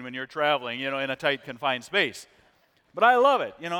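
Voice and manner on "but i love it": excited exclaim. Real, not acted. Enthusing about kimchi.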